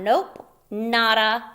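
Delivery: usual speaking voice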